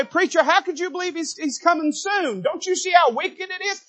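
high-pitched mock objector voice; mock doubting listener. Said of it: "You say".

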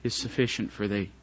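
a hushed, tender God answering Paul; God speaking gently. Acted. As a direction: comfort Paul quietly as God. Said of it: "the Lord looked at him and said".

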